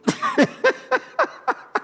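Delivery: long, high laugh in bursts; high-pitched laugh. Real, not acted. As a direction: laugh high at your own story. Laughs at own pastor anecdote.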